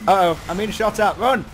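loud, high-pitched frantic calls; excited combat shouting. Real, not acted. Player under fire then scoring a kill, excited shouting. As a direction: yell it in the heat of the fight.

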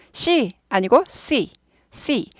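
C said slowly with a sharp, hissing s; very slow sharp C demonstration; sharp C sound. Shown as a demonstration. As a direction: stretch out the C with a crisp s. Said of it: "날카로운 발음입니다".

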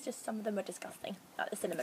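soft conspiratorial whisper; whispered aside. Real, not acted. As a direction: drop to a secret whisper.

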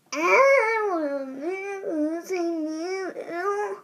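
slow, wavering cracking voice; drawn-out, wobbly adolescent voice crack; puberty voice crack. Shown as a demonstration. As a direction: let the voice crack like puberty hit. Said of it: "your voice cracking when you go into puberty".

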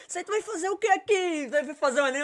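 high, surprised questioning voice; curious student girl. Retold or imitated. Student asks her trainer why he's there, high and curious. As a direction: raise the pitch, nosy and surprised.

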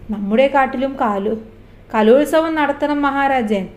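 ordinary delivery

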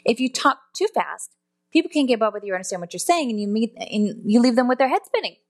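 hurried demo of talking too fast. Shown as a demonstration. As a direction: rattle it off too quickly to follow. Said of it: "rate of speech is also incredibly important".

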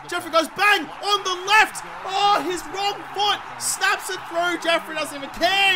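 high, drawn-out commentary; excited goal call. Real, not acted. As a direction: call the goal with rising amazement, stretching the words. Calls Jeffrey's goal like a commentator.

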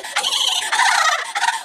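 high-pitched flirty giggle; put-on high giggle; coy giggle. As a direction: giggle coyly at a crush.

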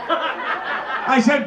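ordinary delivery

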